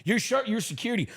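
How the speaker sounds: high, incredulous outburst at the guard; retold furious disbelief, with a laugh; exasperated yell. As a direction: throw your hands up, pitch shooting high. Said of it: "I go".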